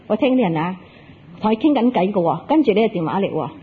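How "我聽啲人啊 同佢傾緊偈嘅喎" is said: ordinary delivery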